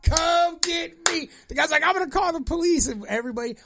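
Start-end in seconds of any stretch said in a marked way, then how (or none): 0.0-1.3 high, drawn-out cocky dare; slow taunt. Retold or imitated. Fireworks guy taunts from outside. 1.8-2.9 huffy neighbor threat. Retold or imitated.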